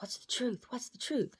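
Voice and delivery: high-pitched mock pleading for an answer; pressing questioners. Uncle and Peg demand answers in the dream, high mock questioning. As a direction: imitate relatives pressing, voice jumping high.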